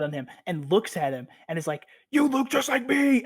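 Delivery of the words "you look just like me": mock manic exclamation. Sun's deranged exclamation, retold.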